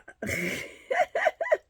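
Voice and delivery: high-pitched burst of laughter. Real, not acted. Laughs out loud at herself.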